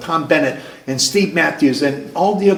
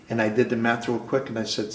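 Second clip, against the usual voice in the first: ordinary talk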